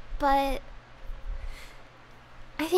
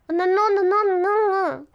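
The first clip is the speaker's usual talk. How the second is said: rapid louder frustrated nos; 4 semitones higher, 6 dB louder than usual. Real, not acted. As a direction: scold yourself for the slip. Fumbling the same word twice.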